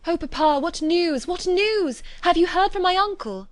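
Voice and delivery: high, loud, excited questioning; eager cry. A narrator's reading. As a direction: burst out with urgent questions for papa. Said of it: "eagerly cried out".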